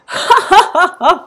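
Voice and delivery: high, loud burst of laughter; hearty guffaw. A narrator's reading. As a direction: burst out in a big royal laugh. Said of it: "Le roi s'exclaffa". (grand éclat de rire)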